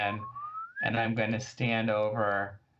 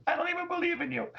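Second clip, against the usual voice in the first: high, quick, giggly aside to the goddess; laughing squeak; faster than usual. Retold or imitated. Player shrugs off her own prayer, high and giggling. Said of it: "and I'm like".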